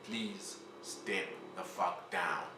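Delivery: the second ask, hushed and drawn out; quiet slow plea. Acted. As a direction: say it low and weary, like a last request. Repeated plea after begging.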